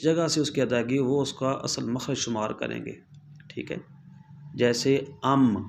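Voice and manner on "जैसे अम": "अम" said slowly with the lips closing on the M; demo of the sound. Shown as a demonstration. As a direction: draw out "अम" so the lips meet on the final M. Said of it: "मीम्स को साकिन किया".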